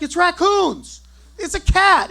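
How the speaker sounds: high, loud shouted answers; shouting back. Retold or imitated. Husband shouting back to his wife at night. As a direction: shout across the house over the dogs. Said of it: "I shout back".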